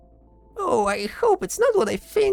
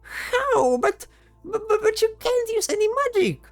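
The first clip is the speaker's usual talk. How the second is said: stammering disbelief; 7 dB louder than usual. Acted. Companion baffled by sudden magic.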